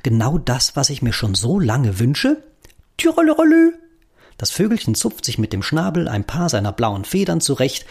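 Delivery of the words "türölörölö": high chirpy budgie trill; sing-song bird twitter; birdsong trill. Acted.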